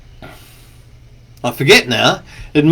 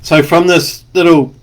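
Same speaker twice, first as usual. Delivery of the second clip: ordinary talk, nothing marked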